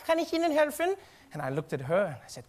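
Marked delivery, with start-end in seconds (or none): 0.1-1.0 high, unforced friendly greeting; nice German saleswoman. Retold or imitated. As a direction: imitate a kindly shop woman, pitched high. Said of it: "woman was very nice to me".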